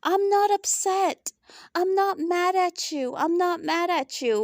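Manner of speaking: put-on denial, starting high and easing down; mock insincere denial. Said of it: "Portia's lying".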